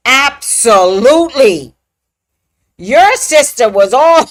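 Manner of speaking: a friend insisting, leaning on it; emphatic friend. Retold or imitated. Friend insists the sister was all over that, loud. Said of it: "She goes".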